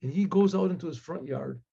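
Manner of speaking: ordinary delivery, nothing marked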